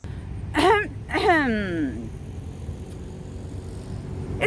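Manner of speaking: a formal throat clear before speaking. Acted. Mary clearing her throat before her speech. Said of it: "She cleared her throat".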